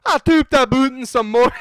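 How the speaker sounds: loud, high, exaggerated Scottish accent. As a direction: belt it out in hammed-up Scottish. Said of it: "How does a Scottish ac".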